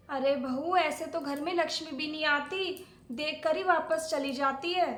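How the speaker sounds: usual speaking voice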